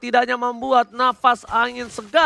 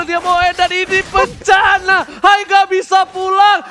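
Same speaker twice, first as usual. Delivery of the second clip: loud, higher shouted hype call; fighting game announcer; 8 semitones higher, 6 dB louder than usual. Real, not acted. Hype call of base push.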